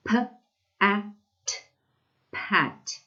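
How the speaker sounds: "P-a-t" each sound apart, then "pat" blended; sounding out words. Shown as a demonstration. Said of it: "So what does it say now?".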